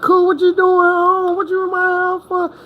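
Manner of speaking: put-on a girlfriend caught out, squealing; baby mama caught. Baby mama caught with another man, high startled. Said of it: "His baby mama come up to him like".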